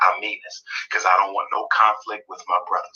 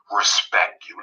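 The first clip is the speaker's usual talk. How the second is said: ordinary delivery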